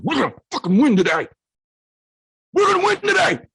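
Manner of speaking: rally him like a fired-up coach; fired-up yell. Retold or imitated. Dad yelling at his son that they will win, fired up.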